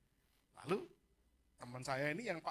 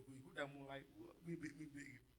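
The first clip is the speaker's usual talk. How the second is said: ordinary talk, nothing marked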